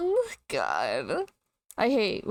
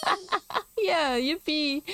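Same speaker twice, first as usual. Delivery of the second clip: excited cheer; 6 semitones higher than usual. Real, not acted. Cheering as a feature returns.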